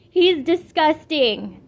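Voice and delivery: reacting to the dog, loud and slow; disgusted. A narrator's reading. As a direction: drag out the disgust.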